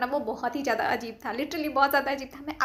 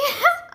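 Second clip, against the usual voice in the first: burst of laughter; 9 dB louder than usual. Real, not acted. Giggles while retelling teacher's reaction.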